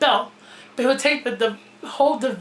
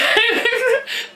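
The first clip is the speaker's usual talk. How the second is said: burst of high laughter; 11 semitones higher than usual. Real, not acted. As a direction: crack up at your own rant. Bursts out laughing at own rant.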